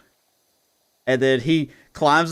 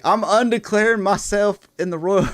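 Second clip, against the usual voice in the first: higher, louder goofy declaration; R-Truth; 10 semitones higher, 7 dB louder than usual. Retold or imitated. R-Truth backing out of the Royal Rumble, loud and goofy. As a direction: announce it proudly, like it's a big deal. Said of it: "he's like".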